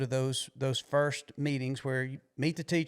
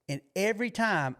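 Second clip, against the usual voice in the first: usual speaking voice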